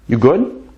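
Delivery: "good" swinging upward; question intonation. Shown as a demonstration. Teacher showing the question form, rising "You good".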